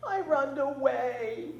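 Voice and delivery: put-on high, slow toddler voice; little boy voice. A narrator's reading. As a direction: speak like a small lost child. Narrated toddler voice.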